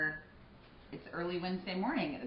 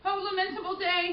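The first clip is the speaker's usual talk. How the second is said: high, loud grieving cry; wailing lament; 11 semitones higher, 5 dB louder than usual. Acted. Nurse mourning Juliet, high wail. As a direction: cry it to the heavens.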